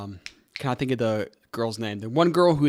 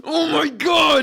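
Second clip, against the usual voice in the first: high, drawn-out loud cry; helpless shout; 13 semitones higher, 6 dB louder, much slower than usual. Real, not acted. Co-host losing it over teasing, high drawn-out shout. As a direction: wail it out long and high.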